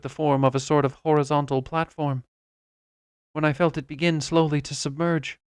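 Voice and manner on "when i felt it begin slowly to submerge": usual speaking voice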